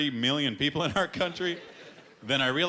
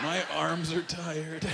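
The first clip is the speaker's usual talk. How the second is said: put-on slow, higher whiny drunk voice; drunk boyfriend; 5 semitones higher, much slower than usual. Boyfriend too drunk to dance, slow whine.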